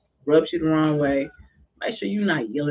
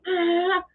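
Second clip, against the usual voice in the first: mock whimper. Player crying after yelling.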